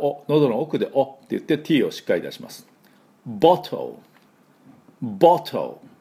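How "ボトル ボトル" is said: ボトル as short "bot-tol", t released firmly; short o from the back, clear t in ボトル; British bottle. Shown as a demonstration. Teacher leading British-style repetition, ボトル with crisp t. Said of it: "イギリス式は".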